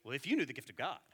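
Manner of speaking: Jesus read as a smug scold; condescending tone. Shown as a demonstration. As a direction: say it with a scornful edge. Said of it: "with this tone of voice".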